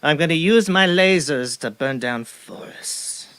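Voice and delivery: slow, relishing movie-villain menace; hammed-up evil mastermind voice; Bond villain. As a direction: drag it out like a scheming supervillain. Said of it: "there's some Bond villain out there".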